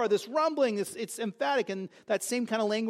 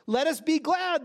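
exultant cry; 7 semitones higher, 4 dB louder than usual. Acted. The voice cries in the vision.

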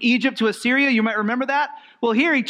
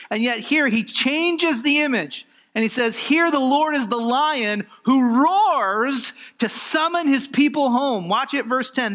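higher, building preacher's cadence; impassioned preaching; 3 semitones higher than usual. Acted. Preacher stirring the congregation about the Lord's roar, with fervour. Preaching the roaring lion passage.

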